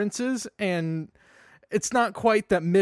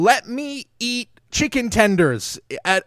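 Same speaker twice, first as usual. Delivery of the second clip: a half-hearted angry yell, a bit louder; mock scream; 6 semitones higher, 5 dB louder than usual. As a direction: rant like an armed protester, hammed-up.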